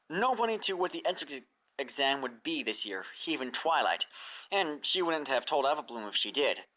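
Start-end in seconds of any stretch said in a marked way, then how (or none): none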